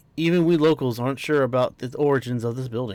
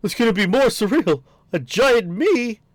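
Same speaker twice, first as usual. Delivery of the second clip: higher, louder, astonished; amazed exclaim; 8 semitones higher, 4 dB louder than usual. Acted. A giant gasping at seeing a giant version of herself, higher and amazed. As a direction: say it in wide-eyed disbelief. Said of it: "re-reads line with more energy".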